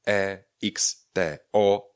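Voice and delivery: slow letter-by-letter spelling of T E X T O; each letter of T E X T O on its own. Shown as a demonstration. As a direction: dictate T, E, X, T, O with gaps. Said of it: "tam, kde máte x-ko".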